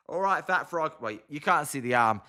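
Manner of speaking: usual speaking voice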